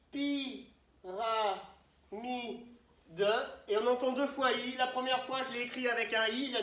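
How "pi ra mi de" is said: « pi, ra, mi, de » posé, une pause entre chaque; syllabe par syllabe. Shown as a demonstration. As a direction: dicte lentement « pi, ra, mi, de » en syllabes séparées. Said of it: "Je peux les couper comme ça". (« pi, ra, mi, de » découpé, sans forcer)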